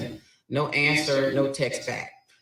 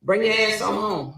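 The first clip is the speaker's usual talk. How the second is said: louder, sharp order with a laugh in it; furious order; 5 semitones higher, 6 dB louder than usual. Retold or imitated. Wife ordering cheating husband home, angry with a laugh. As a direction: boss him home. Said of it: "I was like".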